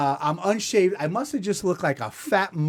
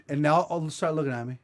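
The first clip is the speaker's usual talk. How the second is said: usual speaking voice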